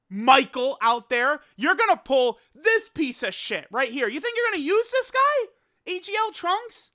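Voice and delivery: loud rant climbing to high disbelief. Acted. Scolding Michael over his bad pull, ranting. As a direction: berate him, pitch rising into a yell.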